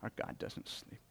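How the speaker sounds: hushed, breathy whisper of the same words. Shown as a demonstration. Pastor repeating the line to the congregation, whispered. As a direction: drop to a reverent whisper.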